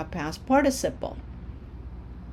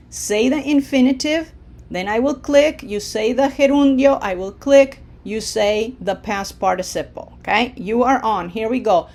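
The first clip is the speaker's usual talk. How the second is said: ordinary talk, nothing marked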